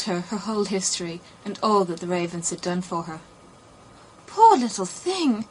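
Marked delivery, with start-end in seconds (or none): 4.3-5.5 pitying. A narrator's reading. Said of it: "said the prince and princess".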